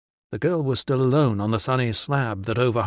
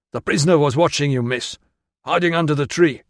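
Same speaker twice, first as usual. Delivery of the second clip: gruff; 4 semitones higher, 4 dB louder than usual. Acted. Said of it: "said gruffly".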